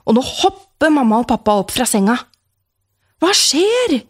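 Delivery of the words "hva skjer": much higher startled call; jolted awake, calling out; dad calls out. A narrator's reading. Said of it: "roper pappa".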